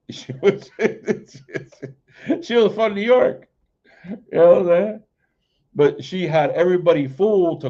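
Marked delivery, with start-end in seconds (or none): 0.1-5.0 talking through laughter, pitched up; giggling while telling the punchline; amused laughing speech. Real, not acted. Laughing at the Miss Cleo memory.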